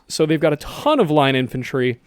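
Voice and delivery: usual speaking voice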